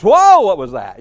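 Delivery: loud, jumpy startled gasp of a churchgoer; startled cry. Retold or imitated. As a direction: jump at a sudden voice. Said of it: "they would just".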